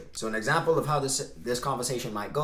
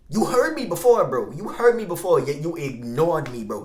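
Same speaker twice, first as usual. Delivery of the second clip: mock scolding shout; 4 semitones higher, 7 dB louder than usual. Mock scolding viewers about like button.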